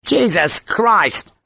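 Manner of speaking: high, drawn-out exasperated oath; shouted. A narrator's reading. Sharp learning of the noon trial, shouting. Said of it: "Sharp shouted the words".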